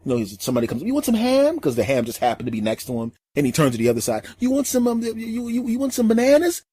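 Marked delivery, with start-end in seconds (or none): 0.8-1.6 put-on very high, eager kid chatter; little kid voice. The boy greets everyone while offering food, very high and eager. 4.4-6.6 put-on very high, eager kid chatter; little kid voice. The boy greets everyone while offering food, very high and eager.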